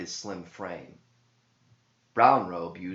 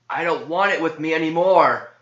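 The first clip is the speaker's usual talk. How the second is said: strained high groan, much louder; a tortured man groaning out; possessed man's loud strained groan; 8 semitones higher, 11 dB louder than usual. Acted. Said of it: "groaned John with a look of tired resignation".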